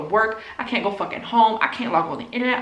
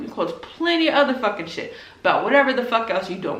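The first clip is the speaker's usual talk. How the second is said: ordinary talk, nothing marked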